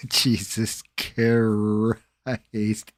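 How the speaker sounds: exasperated reaction to a chat comment; drawn-out exclamation. Real, not acted. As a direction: drag it out in disbelief.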